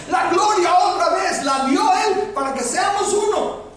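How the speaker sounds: loud, high-pitched shouted preaching; full-force preaching. Real, not acted. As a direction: drive each point home at a shout. (predicación a gritos, con fuerza)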